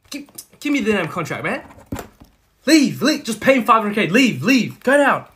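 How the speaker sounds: high, loud, furious boss; angry shouted orders. Acted. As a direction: shout them out of the room.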